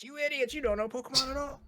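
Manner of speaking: high-pitched mock outrage of an angry commenter; mock screaming. Imagined commenter scolding the host for not knowing Pokemon, mock screaming. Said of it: "somebody screaming in the comments".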